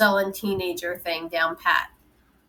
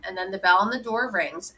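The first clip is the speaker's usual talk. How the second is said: plain reading voice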